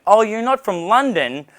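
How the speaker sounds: lightly acted pleasant surprise; surprised interest. Girls suddenly interested in the foreigner.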